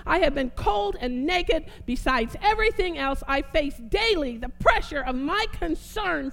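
strong, declamatory Bible reading; rolling, emphatic pulpit delivery; preaching scripture reading. Real, not acted. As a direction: proclaim each item of the list, leaning hard on key words.

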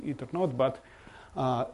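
ordinary talk

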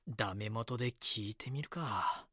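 soft, slow thinking aloud; musing to himself. Acted. Reporter alone considers asking the neighbours, musing. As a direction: quietly talk yourself into trying.

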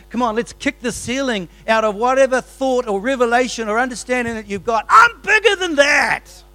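loud, high booming voice of God; God voiced. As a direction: burst through the ceiling. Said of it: "He's gonna say".

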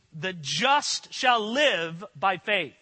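"The just shall live by faith" spoken slowly, every word weighted; slow, deliberate emphasis on "The just shall live by faith"; stress on the phrase. Shown as a demonstration. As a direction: lean on each word, slowly. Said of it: "with the emphasis on the phrase".

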